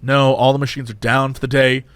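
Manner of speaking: low, hesitant cashier; drive-through guy. Retold or imitated. Cashier answering an angry customer, low and subdued. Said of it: "he's like".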